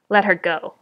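usual speaking voice

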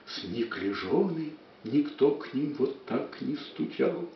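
builds up loud, fades to a hush; dramatic poetry declamation. Acted. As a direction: swell the verse, then sink to whispering. Poem declaimed, fading.

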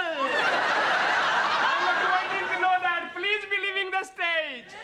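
slow, laughing mock reply; laughing teacher, slowed right down. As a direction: laugh through it slowly. Teacher mocks student's phrasing.

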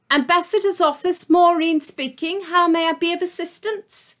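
high, polite secretary voice; receptionist voice. Acted. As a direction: answer the phone like a prim receptionist. Receptionist answers the phone.

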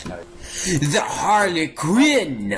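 high, very drawn-out "Harlequin"; dramatic exclamation. Pretend. Gamer names the suspect, dramatic cry. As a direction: stretch "Harlequin" out high and theatrically. Dramatic naming, then repeated plainly.

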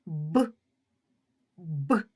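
short, separate Б, said twice; a brief lone Б burst with a pause between; isolated sound Б. Shown as a demonstration. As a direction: sound out Б alone, not as a letter name. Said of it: "Вимовимо перший звук цього слова".

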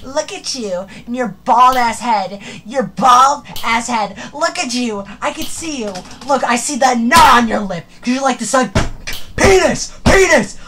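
hammed-up jeering shouts; loud taunting. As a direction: taunt him to his face. Taunting Baldi loudly.

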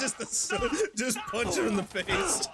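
high, laughing speech; cracking up. Real, not acted. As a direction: talk through real laughter. Laughing at game action.

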